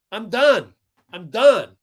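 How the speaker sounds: loud, emphatic exasperation; real indignant outburst; fed up. Real, not acted. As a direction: declare it loudly, completely fed up. Fed up with opponent's dirty play.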